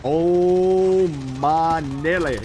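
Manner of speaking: loud, drawn-out amazement; astonished cry. Real, not acted. Gamer reacting to the action, loud drawn-out astonishment. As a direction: stretch it out, loud and stunned.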